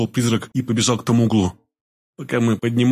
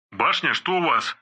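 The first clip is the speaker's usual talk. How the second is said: quiet, slow, as if through a walkie-talkie; radio voice; 5 semitones higher, 4 dB quieter than usual. A narrator's reading. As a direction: voice over a crackling radio. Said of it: "спросил рация голосом Боинга".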